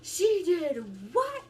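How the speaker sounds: high, loud, slow disbelief; aunt on the phone, stunned; shocked aunt. Retold or imitated. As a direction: stretch it out in outraged shock. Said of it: "I hear my aunt go".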